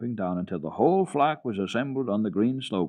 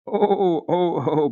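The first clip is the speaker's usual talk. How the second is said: high, loud protesting oh-oh-oh; boy protesting a dangerous idea; strong disapproval; 9 semitones higher, 7 dB louder, much slower than usual. A narrator's reading. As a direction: cry out in alarm and refusal. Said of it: "with strong disapproval".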